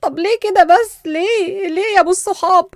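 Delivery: loud, high yelp of complaint; pained protest. Acted. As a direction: cry out as if just smacked. Reacting to being slapped on the nape.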